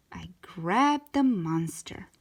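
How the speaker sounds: ordinary delivery, nothing marked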